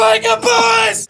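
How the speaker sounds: high, slow, loud cheer; loud shout. Acted. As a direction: bellow it triumphantly. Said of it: "Using a lot of volume".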